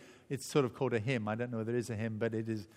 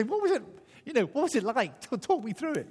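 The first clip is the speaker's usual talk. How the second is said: eager; 9 semitones higher, faster than usual. Pretend. Asking saints in heaven.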